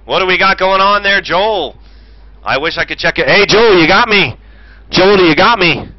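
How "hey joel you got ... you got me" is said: louder radio call, hailing the driver; calling over the radio. Acted. Commentator hails driver on the radio, loud call. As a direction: call out to get through on the radio.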